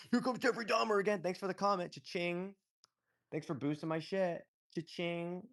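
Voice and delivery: high, sing-song mock delight; mock gloating. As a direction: cash in with a gleeful, teasing lilt. Mimics gloating replies to commenters.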